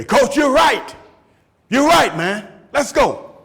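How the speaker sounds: rally behind the coach; eager player. Retold or imitated. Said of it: "where other players say".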